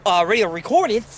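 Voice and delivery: ordinary delivery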